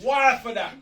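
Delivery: "why for that?" shouted high and loud; shouted catchphrase. Shown as a demonstration. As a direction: belt out "why for that?" full force. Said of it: "I had to just the point".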